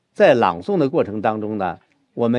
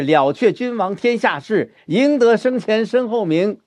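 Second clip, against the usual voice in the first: 了却君王天下事 raised and firm, building; rising recital; 9 semitones higher, 3 dB louder than usual. Shown as a demonstration. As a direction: declaim higher, proud. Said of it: "再来转". (昂扬朗诵，语调抬高)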